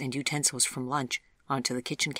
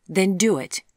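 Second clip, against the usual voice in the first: challenging; 5 semitones higher, 9 dB louder than usual. A narrator's reading. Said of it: "Vivian challenged him".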